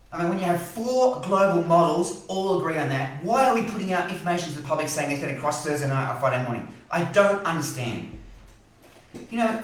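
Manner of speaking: venting real frustration at the Bureau; worked-up rant. Real, not acted. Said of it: "I nearly clearly need to calm down".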